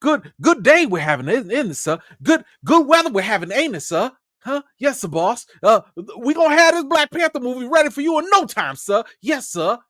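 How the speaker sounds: high, eager, hammed-up subservience; mock a fawning 1800s servant greeting the boss; servile slave caricature. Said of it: "the way that they really wanted".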